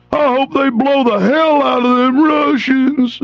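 high, slow mocking drawl of a clueless American. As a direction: ham up a yokel cheering for bombs. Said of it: "I've heard 'em".

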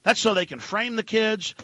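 normal talking voice

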